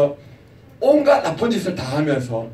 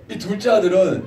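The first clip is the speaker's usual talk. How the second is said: usual speaking voice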